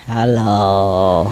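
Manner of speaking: low, drawn-out sleepy hello; bird talking in dreams. Retold or imitated. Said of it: "they talk in a dream".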